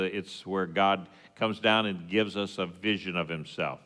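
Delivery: ordinary delivery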